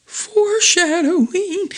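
high, drawn-out singsong word; hammy aside. Acted. Narrator flags a plot hint, high teasing singsong. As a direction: draw it out slowly in a very high voice.

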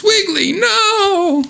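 high-pitched mock scream of warning; alarmed cry. Ann calls out to stop Wiggly, high alarmed cry. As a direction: cry out in a very high voice, drawing out the last word.

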